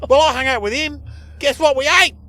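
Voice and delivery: high, loud, excitable talkback caller; caller impression. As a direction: pitch it up like an over-keen radio caller. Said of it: "he sounded like one of your code".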